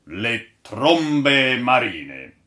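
slow, loud, drawn-out announcement; dramatic exclamation. A narrator's reading. Old sailor opens his tale to the crew, exclaiming slowly. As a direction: proclaim it like a storyteller's title. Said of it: "esclamò Mastro Catrame".

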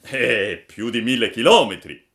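very loud, projected character voice; emphatic pigeon. A narrator's reading. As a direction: boom out the huge distance. Pigeon declares a huge distance.

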